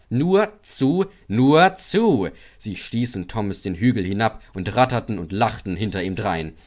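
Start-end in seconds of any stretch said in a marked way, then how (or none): 0.1-2.3 high, loud mischievous egging on; naughty wagons taunting; taunting trucks. A narrator's reading. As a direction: goad him downhill. Trucks pushing Thomas on.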